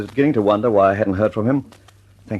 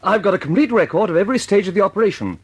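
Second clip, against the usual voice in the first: eager excitement; 6 semitones higher than usual. Acted. Wallace eagerly offers his films.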